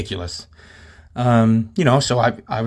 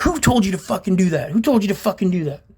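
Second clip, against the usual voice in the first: high-pitched mock yell, demanding; imitated screaming; 7 semitones higher than usual. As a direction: act out his angry screaming, pitched up. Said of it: "like, screaming at me".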